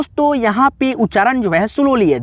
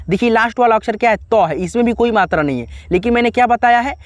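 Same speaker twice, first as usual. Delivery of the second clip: ordinary delivery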